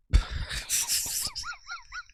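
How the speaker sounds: thin very high giggle; real squeal of laughter; squeaky high laugh. Real, not acted. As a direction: break up laughing. Laughing at the booger memory.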